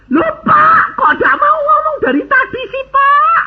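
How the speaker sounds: high, shouted mock complaint; hammed-up outcry from an astonished seller; exclaiming protest. Retold seller protesting loudly.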